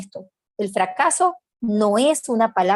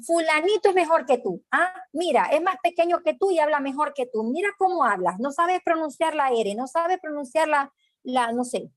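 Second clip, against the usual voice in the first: higher, scornful parent voice; comparing parent; 6 semitones higher than usual. Retold or imitated. Parent mocking how the child pronounces the R, higher scornful voice. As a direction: belittle the child by comparing him to a younger kid.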